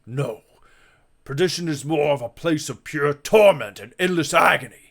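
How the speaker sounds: ordinary delivery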